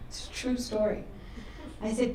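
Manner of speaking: ordinary talk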